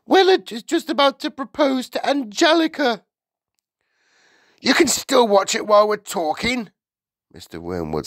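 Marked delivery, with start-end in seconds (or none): 0.0-3.0 high, loud, anguished complaint; distraught high-pitched wife; crying out. A narrator's reading. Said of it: "Missus Wormwood cried out". 4.6-6.7 high, loud retort; loud, impatient husband; exasperated husband. A narrator's reading. Husband answers wife's outcry.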